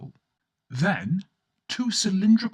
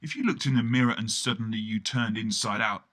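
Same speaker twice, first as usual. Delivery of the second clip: ordinary delivery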